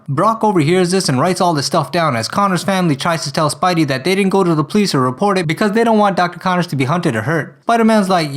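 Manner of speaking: ordinary delivery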